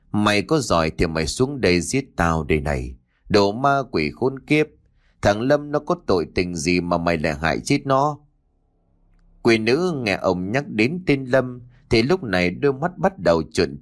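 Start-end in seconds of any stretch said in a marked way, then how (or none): none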